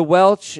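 "Welch" ending in a hard ch, not sh. Shown as a demonstration. Host reading the email's misspelling aloud, careful pronunciation. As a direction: close "Welch" on a ch. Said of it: "he actually said the Welch".

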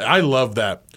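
ordinary talk, nothing marked

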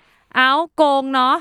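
very slow, with the last word เนาะ stretched and dropped; trailing เนาะ tail. Shown as a demonstration. Co-host demos her dragged-out sentence tail, very slow. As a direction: drag out เนาะ at the end, very slowly. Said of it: "ทิ้งเสียงแบบ".

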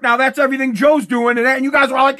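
ordinary delivery

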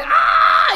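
high loud mock shriek; mock scream. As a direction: scream like a fleeing guy. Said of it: "Huyeron, fue de". (grito agudo burlón)

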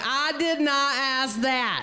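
slow, emphatic mock indignation; irritated retort. Comedian correcting the promoter, irritated. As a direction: push back slowly, word by word.

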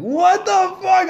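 a high, drawn-out, shocked "what the fuck"; heartbroken shock. Retold or imitated. Hearing his crush went down on his friend, shocked and slow. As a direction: stretch it slowly in hurt disbelief.

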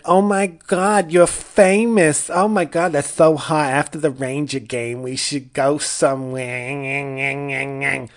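high, slow, gushing Long Island girl voice, mocking; Long Island party girl. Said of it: "Long Island skank".

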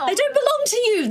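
scolding. Acted. Scolding the hog in character.

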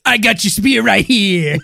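loud slow tough-guy boast; macho taunt. Pretend. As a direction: growl it like a swaggering bully.

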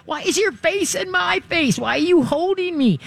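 high squeaky alarmed dog. Retold or imitated. Rescue dog startled by a hug, high alarmed squeak. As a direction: play the startled dog being hugged. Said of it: "And the dog goes".

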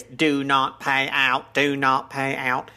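slow, measured robotic monotone; mock robot announcement, slowly intoned; Dalek-like machine voice. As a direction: imitate a spacey sci-fi machine voice, each word drawn out. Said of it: "the, the, the voice".

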